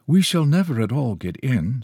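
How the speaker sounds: high, whiny complaint; whining. A narrator's reading. Woman in the bus queue complaining, whining. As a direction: draw the words out in a plaintive whine. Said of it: "with a whine in it".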